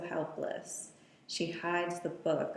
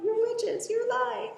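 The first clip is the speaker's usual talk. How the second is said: shrill accusation. Retold or imitated. Voicing Mia accusing the coven.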